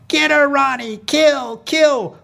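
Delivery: high-pitched rooting holler; bloodthirsty cheer. Acted. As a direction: yell the driver on to destroy the rival. Family yells back at mom.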